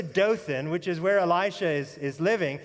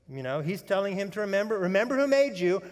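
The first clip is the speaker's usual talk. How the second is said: normal talking voice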